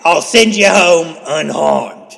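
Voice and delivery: theatrical British offer; bad British accent. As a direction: proclaim it in a hammed-up British accent. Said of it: "that's the best British accent".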